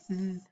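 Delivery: TH as a soft, voiced sound alone; isolated digraph sound. Shown as a demonstration. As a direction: sound out "th" as in feather.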